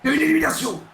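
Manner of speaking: high, loud elimination call; announcer call. Real, not acted. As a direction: call it out to the whole arena. (annonce criée et aiguë)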